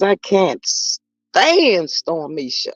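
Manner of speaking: "can't stand" drawn out slowly for emphasis; emphatic. Real, not acted. Host reading out Miss Rose's comment, with heavy emphasis. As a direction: lean hard and slow on "stand". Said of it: "I had to put some emphasis on it".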